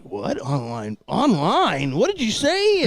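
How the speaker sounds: rising, baffled newcomer echoing the word online; mock confusion. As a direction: play a puzzled bar regular, climbing to a high squeak on what did you say. Retold confused bar newcomers.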